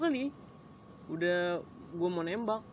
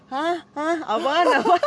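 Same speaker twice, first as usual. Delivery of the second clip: high, loud, fast mock "what?"; friends' hyped teasing; 11 semitones higher, 11 dB louder, slower than usual. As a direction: yell like hyping friends. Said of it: "temen-temen gue pada kompor".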